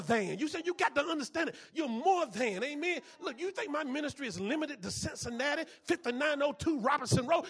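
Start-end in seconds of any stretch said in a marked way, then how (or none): none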